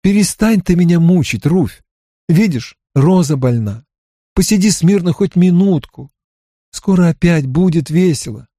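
mildly pleading, pitch lifted; pleading mother. Acted. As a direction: beg a pestering child for a moment's peace. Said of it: "взмолилась мать".

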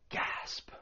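quick unvoiced gasp of shock; sharp gasp. Shown as a demonstration. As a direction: gasp in sharply through the mouth. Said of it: "It's just that one breath".

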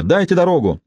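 understated narrated shout; mock yell. A narrator's reading. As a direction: call to clear the way, restrained. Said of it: "завопил я".

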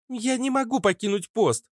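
put-on high-pitched girlish refusal; insolent girl. Girl guard refuses to leave post, cheeky and high. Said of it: "заявила нахалка".

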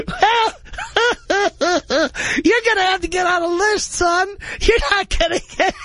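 high, cackling disbelief; mocking laughing clerk. Ticket clerk laughing at a newcomer wanting season tickets, high and cackling. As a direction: laugh the caller right off the phone. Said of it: "And one was like".

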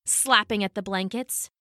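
ordinary delivery, nothing marked

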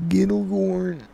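high prank caller voice. Pretend. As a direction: play the oddball caller, high-pitched.

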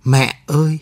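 understated cry of fear; a mild, narrated wail for mother. A narrator's reading. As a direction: call out in fright without really screaming. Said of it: "khóc thét lên".

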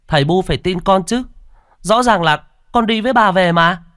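put-on high, loud child's protest; insistent boy. A narrator's reading. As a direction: plead to be believed as a small boy. Boy insists parents believe him.

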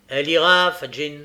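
loud first word, then settling; firm order. A narrator's reading. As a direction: state it firmly, as decided. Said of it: "dit Sikes".

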